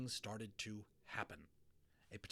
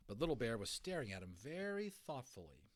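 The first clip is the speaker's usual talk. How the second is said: ordinary delivery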